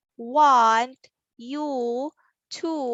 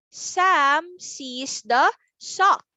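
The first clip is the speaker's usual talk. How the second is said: slow reading; 3 semitones higher, 3 dB louder, much slower than usual. Shown as a demonstration. Teacher models reading sentence.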